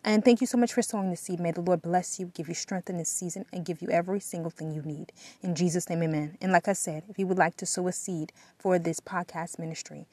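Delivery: ordinary talk, nothing marked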